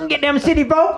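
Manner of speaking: high-pitched mock hillbilly; hammed-up yokel squeal; hee-haw yokel. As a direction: jeer like a cartoon country bumpkin. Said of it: "hee-haw type joke".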